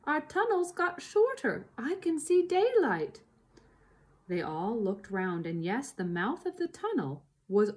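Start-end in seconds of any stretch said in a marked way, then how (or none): 0.0-3.2 put-on high, alarmed child voice; small fox. A narrator's reading. Small fox telling the family, high excited voice. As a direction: pitch it up like a little fox raising the alarm. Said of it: "said one of the small foxes".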